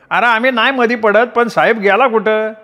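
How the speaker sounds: pry innocently; curious bystander. A narrator's reading. Bystander asks where he went. (चौकस गावकरी)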